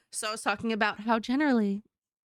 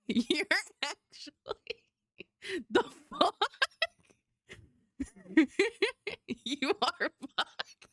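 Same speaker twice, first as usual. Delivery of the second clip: laughing squeak; 8 semitones higher, slower than usual. Acted. Laughing high put-on voice.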